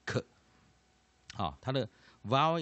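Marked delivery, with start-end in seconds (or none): 0.1-0.2 "k" as the bare consonant sound; isolated K sound. Shown as a demonstration. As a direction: say "k" as a hard K, not the letter's name. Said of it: "念k".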